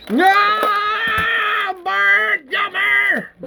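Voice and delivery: high, drawn-out desperate wail; horrified shout. Acted. Horrified Heather calling Bert back, screaming. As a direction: scream for him to come back.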